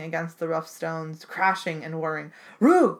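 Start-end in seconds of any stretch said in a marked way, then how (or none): 2.6-3.0 loud high barking Roog; dog's bark cry. Acted. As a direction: bark it like a frantic dog. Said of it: "Boris cried".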